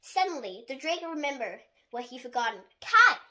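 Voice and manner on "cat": loud high shout of a name. A narrator's reading. Dragon shouting for his lost cat, loud alarm. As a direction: yell it out in alarm. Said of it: "he shouted".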